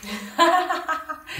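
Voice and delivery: a burst of high laughter; laughing. Real, not acted. Preacher laughs at his joke about the bread, high laugh. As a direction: laugh at your own joke.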